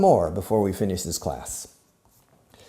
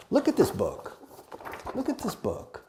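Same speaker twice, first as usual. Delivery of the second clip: 1 mock disgust; 12 semitones higher, 3 dB quieter than usual.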